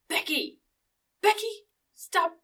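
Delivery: high-pitched scolding call; hammed-up name calling; mock parental yell. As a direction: holler after a child, playfully. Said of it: "it's perfect to yell".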